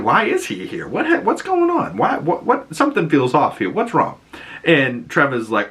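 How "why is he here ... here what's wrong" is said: very high, stammering mock confusion; baffled alarm. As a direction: voice a suspicious character leaping into high-pitched questions. [name]'s acted growing alarm.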